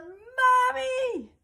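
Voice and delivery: high loud shout for dad; yelling. Acted. Girl trapped in a box calling her dad, long high shout. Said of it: "She yelled".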